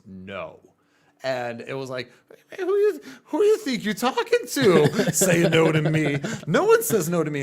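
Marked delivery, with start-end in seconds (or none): 0.1-0.6 imitating a friend's low, growly refusal; a touch of deep growl, mildly imitated. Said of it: "in this deep, growly voice". 2.6-7.4 hammed-up outraged shout, much higher and louder; indignant angry retort. Acts out own angry retort.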